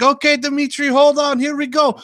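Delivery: high, loud hammed-up Eastern European worker voice; mock Eastern European accent. A worker on the ladder, in a hammed-up Eastern European accent. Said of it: "people from Eastern Europe or something".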